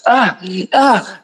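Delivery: high strained mock moans of pain; pained groans. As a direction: act out the groaning at the counter. Retold pained groans at counter.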